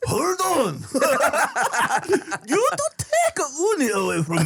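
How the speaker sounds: high laughing mock Japanese accent. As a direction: slang in a hammy Japanese accent. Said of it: "but just with a Japanese accent".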